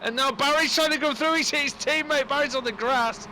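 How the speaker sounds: high-pitched, thrilled race commentary; excited play-by-play. Real, not acted. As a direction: call the crash live with rising alarm. Live play-by-play.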